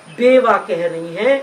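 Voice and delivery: usual speaking voice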